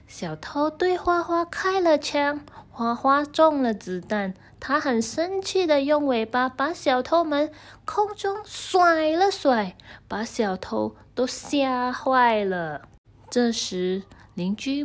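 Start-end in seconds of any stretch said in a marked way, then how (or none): none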